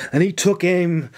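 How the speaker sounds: ordinary delivery, nothing marked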